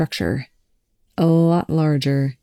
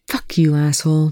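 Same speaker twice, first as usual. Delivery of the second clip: plain reading voice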